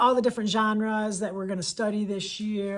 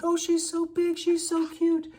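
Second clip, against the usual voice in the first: cooing adults; 10 semitones higher, 3 dB louder than usual. Retold or imitated. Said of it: "The story of a kid".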